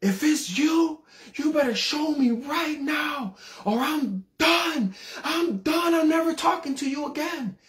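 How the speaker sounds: very high, drawn-out yelling wail, acted; mad ultimatum. Teenager yelling at the Lord on the porch, mad. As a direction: cry out an angry threat, pitch soaring. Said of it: "and I got mad".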